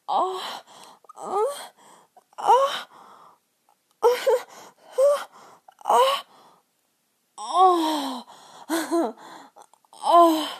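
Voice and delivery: loud, high moaning cries; climax moans. Acted. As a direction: cry out in long, breathy bursts.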